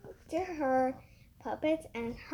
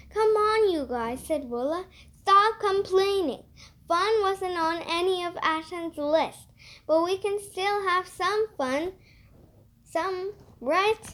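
higher, louder bossy cheer-up; bossy girl Willa; 8 semitones higher, 6 dB louder than usual. A narrator's reading. Willa telling the hungry campers to stop complaining, loud and higher. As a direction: snap them out of complaining, then coax.

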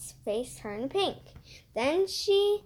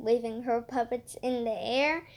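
usual speaking voice

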